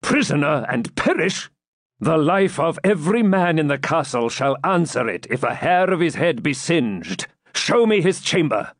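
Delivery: high, urgent knightly outrage. A narrator's reading. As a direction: threaten the captors and demand action.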